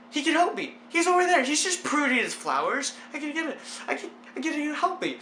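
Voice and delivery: high-pitched mock panic; acted freaking out. Spotting the neighbor while hanging, mock-panicked hope. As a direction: fret aloud in a hammed-up fluster. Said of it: "while I'm freaking out".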